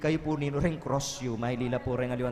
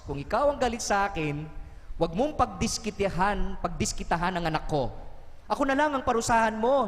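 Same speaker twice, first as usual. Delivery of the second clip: raised-pitch imitation of an angry prayer; angry pleading; 6 semitones higher than usual. Parent begs God to spare the sick child, angry pleading. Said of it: "parang galit na galit yung nagdadasal".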